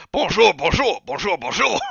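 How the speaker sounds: high, loud put-on bonjours; French animals calling. Narrator imitates the enclosure calling bonjour. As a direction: chirp the bonjours like caged Frenchmen. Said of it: "All we heard was".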